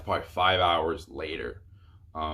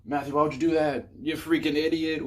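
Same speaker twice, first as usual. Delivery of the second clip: higher, mocking imagined viewer rebuke; mock viewer scolding; 8 semitones higher, 4 dB louder than usual. Imagined viewers scold him for sending his number, mocking higher voice. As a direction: play a jeering commenter calling him dumb. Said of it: "before y'all start saying".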